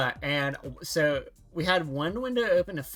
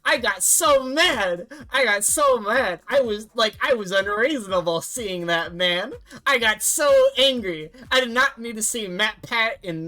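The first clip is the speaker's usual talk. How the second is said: loud, high-pitched exasperated venting; worked-up rant; 5 semitones higher, 6 dB louder than usual. Retold or imitated. Streamer venting to viewers about a horrible movie night, worked-up and loud. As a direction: rant with exaggerated outrage, voice pushed up and loud.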